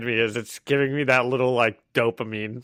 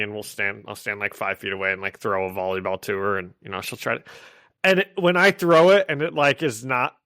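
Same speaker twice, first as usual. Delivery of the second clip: usual speaking voice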